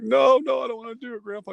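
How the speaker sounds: high-pitched little-boy protest, mock panic; scared pleading. Terrified boy begs grandpa not to send him out, acted pleading. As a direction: beg out of it like a frightened child.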